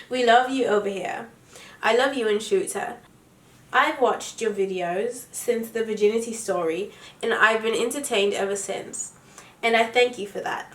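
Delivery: reading aloud in a put-on British accent. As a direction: read it like a Brit. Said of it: "read it in my British accent".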